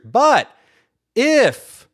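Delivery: a sudden loud, high preacher's stress on if; emphatic but if. Shown as a demonstration. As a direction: punch the word if as the key condition. Preacher stresses the scripture condition.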